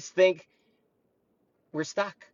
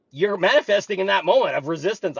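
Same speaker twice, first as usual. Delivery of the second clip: ordinary talk, nothing marked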